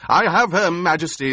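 loud, high triumphant shout. Acted. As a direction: bellow it out in triumph. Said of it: "the Baron shouted".

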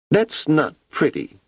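put-on high, slow, unimpressed child; disappointed little girl. A narrator's reading. Wanda judges the dark cube, a let-down child.

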